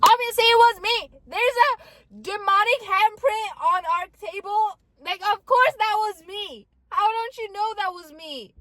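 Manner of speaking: high, loud incredulous protest; comic exasperated outburst. Acted. Exasperated aside about obvious guilt.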